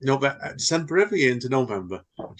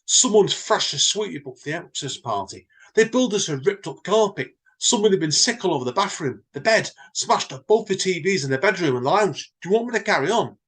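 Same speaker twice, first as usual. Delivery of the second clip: higher, louder manager listing the damage; exasperated manager; 8 semitones higher, 3 dB louder than usual. Acted. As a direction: rattle off the wreckage in disbelief. [name] reads the damage list.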